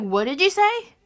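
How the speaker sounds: high-pitched, loud confrontation; loud challenge. Retold or imitated. Sister confronting her sibling's insult, loud high challenge. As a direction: throw it out sharply, high and loud.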